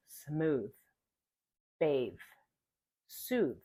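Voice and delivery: smooth, bathe, soothe ending on a long voiced th; exaggerated th practice. Shown as a demonstration. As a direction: hold the final th. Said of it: "in the final position".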